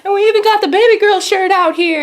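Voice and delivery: high, loud mimicked exclamation; playful hype. Actor noticing a fan's shirt, playful hype.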